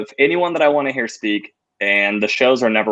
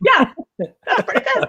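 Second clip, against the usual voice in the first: mock falsetto, way up high; high-pitched Jerry. Friend copying the owner impression in a squeaky falsetto. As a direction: squeak it like a cartoon boss. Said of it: "Seinfeld voice".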